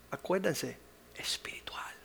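hushed whisper to the congregation. Acted. Preacher whispering a reminder to the church, hushed. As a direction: lean in and whisper it.

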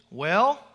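"Well" rising like a question; "Well" with a question mark at the end. Shown as a demonstration. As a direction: lift "Well" upward as an attentive listener. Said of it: "there's a question mark at the end".

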